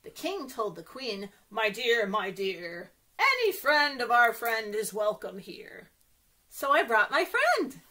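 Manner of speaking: ordinary delivery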